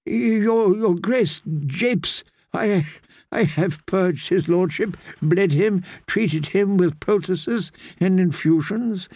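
put-on nervous old man tripping on his words; flustered stammer. Frightened maester defending himself to the queen, stammering. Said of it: "The old man blanched".

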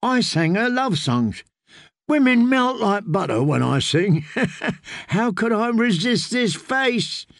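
high tipsy boast with a chuckle; drunken boastful singer. Acted. Singer boasts of his love songs, tipsy and high. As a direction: brag like a pleased, tipsy charmer.

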